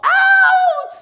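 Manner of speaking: very high, loud ow; sharp yelp. Acted. Girl in the drum yelping as it's struck. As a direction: yelp in pain.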